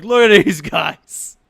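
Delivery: slow, loud, drawn-out emphasis; loud exclamation. Acted. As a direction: draw everyone's attention to the sight. Ogre shows off filthy party.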